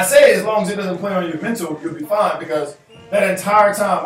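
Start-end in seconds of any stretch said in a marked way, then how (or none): none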